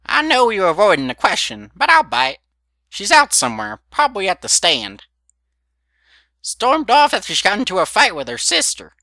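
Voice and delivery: put-on high, loud cackly old mare; old granny voice. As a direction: play a nosy old grandmother. Granny answers about Applejack.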